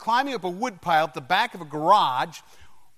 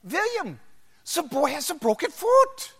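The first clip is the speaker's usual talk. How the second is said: put-on high, emphatic German accent; Doctor Hans Schein; 9 semitones higher than usual. German doctor informing the father after the x-ray, high German accent. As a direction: deliver the diagnosis as a comic German doctor. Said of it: "I'll always remember Doctor Schein".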